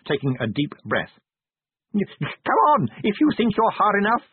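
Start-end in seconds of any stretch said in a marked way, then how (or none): 1.9-4.2 very high, wild battle challenge; frantic small man's war cry; screamed wildly. A narrator's reading. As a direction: scream it out at the enemy, pitched right up. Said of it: "he screamed wildly".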